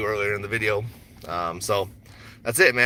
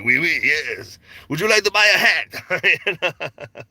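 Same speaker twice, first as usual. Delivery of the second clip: hammed-up Frenchman, higher and drawn out; mock French accent; 6 semitones higher, 3 dB louder, much slower than usual. Shop owner playing French for customers, mock French accent. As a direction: put on a slow, high French shopkeeper accent. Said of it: "play this role of some French man".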